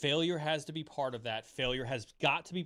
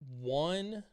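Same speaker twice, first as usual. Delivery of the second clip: gentle reassurance; 4 semitones lower, 3 dB quieter, slower than usual. Retold or imitated. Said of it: "She said".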